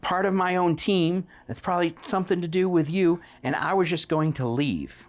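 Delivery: high, pleading captive voice; pleading bounty hunter. Acted. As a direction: beg them to stop attacking you. NPC pleading with the party.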